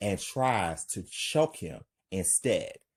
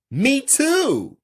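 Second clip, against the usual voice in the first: much higher, louder glad reply; happy; 8 dB louder than usual. Acted. Frank answers rescued Tanisha, happy. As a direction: answer with joy. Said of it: "Frank happy".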